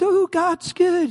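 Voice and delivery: high, slow sing-song mockery; mock-sweet high drawl; mocking pious cliché. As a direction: parody a glib churchgoer. Mocking shallow faith.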